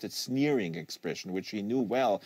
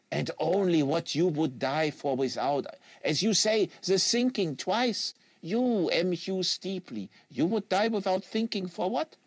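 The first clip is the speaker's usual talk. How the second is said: put-on French Canadian accent; 6 semitones higher than usual. Quebecois agent lecturing the American on fanatic, French Canadian accent.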